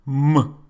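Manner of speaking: M as a bare hummed consonant; isolated M sound. Shown as a demonstration. Teacher showing the sound both alphabets share, isolated M sound. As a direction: hum "M" as a sound, not the letter name. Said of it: "this letter represents M sound".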